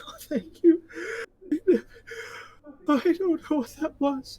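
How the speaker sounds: very high, loud, tearful little voice; relieved halfling. Acted. Rescued halfling thanking Gorthrak, high and tearful. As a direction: squeak out thanks through relieved tears.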